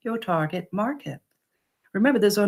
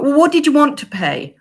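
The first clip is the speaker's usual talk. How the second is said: mock desperate call after a leaving customer, louder; 7 semitones higher, 7 dB louder than usual. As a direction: call them back a little desperately, higher and louder. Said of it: "they start walking away".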